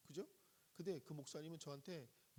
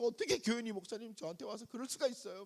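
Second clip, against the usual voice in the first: high, loud wailing complaint; tearful protest; 8 semitones higher, 7 dB louder than usual. Retold or imitated. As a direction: cry out your hurt to the pastor. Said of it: "막 울면서".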